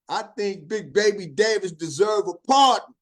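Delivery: ordinary talk, nothing marked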